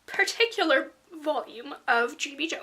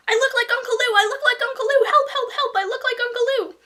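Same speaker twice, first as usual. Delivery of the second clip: high loud wailing panic; a girl shrieking for help about her missing tooth; running and shouting; 9 semitones higher, 7 dB louder than usual. A narrator's reading. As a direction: yell it over and over in hysterics. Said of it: "I kept running and shouting".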